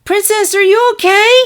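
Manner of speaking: high loud worried call; shouted. Acted. Filly calls to her teacher after the rescue, high worried shout. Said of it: "shouted Sunset".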